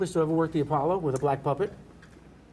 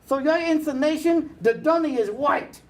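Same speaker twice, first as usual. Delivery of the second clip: high, slow, loud dummy voice; puppet voice; 11 semitones higher, 4 dB louder than usual. Retold or imitated. Dummy Woody roasting the balcony heckler, high and loud. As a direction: work the crowd as the dummy Woody. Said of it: "He goes".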